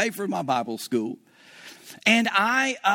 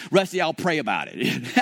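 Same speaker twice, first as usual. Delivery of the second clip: pious brush-off; 6 semitones lower than usual. Retold or imitated.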